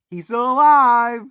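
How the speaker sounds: loud, slow, high cheer of relief; excited relief. Real, not acted. Fan thrilled the dog survived, loud happy cheer. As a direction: celebrate that he made it.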